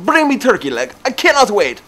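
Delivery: high, loud Russian catchphrase; mock Russian accent. Storyteller hoping to reuse the catchphrase, mock Russian accent. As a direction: quote it in a hammed-up Russian accent. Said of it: "saying things in that accent".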